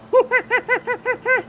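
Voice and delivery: high squeaky monkey laugh; little monkey chattering with glee; monkey giggle. Acted. George answers his friend with a high monkey laugh.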